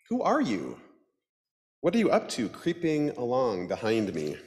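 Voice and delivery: timid duck voice. Acted. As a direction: ask it as a startled duck. Duck character voice.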